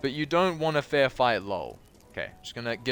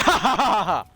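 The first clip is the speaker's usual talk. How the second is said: mock laugh; 9 semitones higher, 7 dB louder than usual. Mocking laugh at reply.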